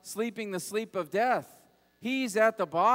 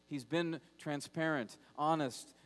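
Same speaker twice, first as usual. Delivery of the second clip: ordinary talk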